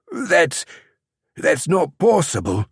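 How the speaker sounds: stammered high disbelief; spluttering. A narrator's reading. Captured champion stunned his oath is cut, spluttering. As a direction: sputter it out, thrown off balance. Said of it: "the man spluttered".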